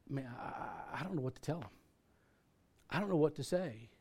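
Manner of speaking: soft, halting confession; at a loss. Retold or imitated. Caller with a dying brother confiding in pastor, subdued. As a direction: trail helplessly, understated.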